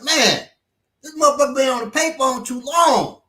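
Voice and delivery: loud, high complaint; impatient yell. Retold or imitated. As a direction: yell it across the bank lobby. Impatient man yelling at payphone.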